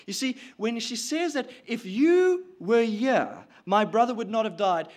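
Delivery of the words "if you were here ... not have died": insinuating blame. Acted. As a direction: lean on the first words to lay blame. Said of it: "she's insinuating blame".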